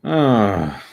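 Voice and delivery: normal talking voice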